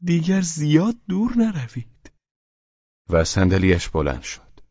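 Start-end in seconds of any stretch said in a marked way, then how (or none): 0.0-2.1 high-pitched pleading; small, high imploring plea; imploring murmur. A narrator's reading. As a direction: beg in a much higher voice.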